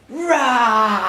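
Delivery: mock roar at the toddler; hammed-up roar at play time; playful monster roar. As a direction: give a friendly monster roar. Playing monster game with child.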